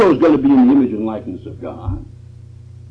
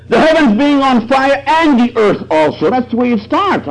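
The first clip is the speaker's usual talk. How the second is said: high, ringing preacher's cry; fervent preaching; 9 semitones higher, 4 dB louder than usual. Acted. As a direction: lift it up to the heavens. Preacher builds on fire image.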